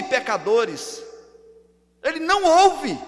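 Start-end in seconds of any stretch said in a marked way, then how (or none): 2.0-3.0 loud, high proclamation; emphatic denial. Real, not acted. Preacher warning that God ignores sinners, emphatic denial. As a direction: declare it forcefully. Emphatic negation, contrasted with next line.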